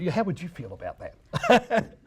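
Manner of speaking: ordinary delivery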